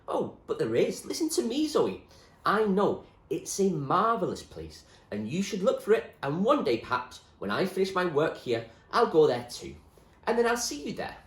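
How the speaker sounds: high, loud, insistent; eager William. Acted. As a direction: promise her eagerly.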